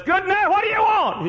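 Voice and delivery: high, loud startled neighbour voice; yanked out of sleep and annoyed; very high put-on voice of a roused sleeper; grumpy woken neighbour. Woken neighbour answers the door.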